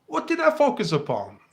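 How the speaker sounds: usual speaking voice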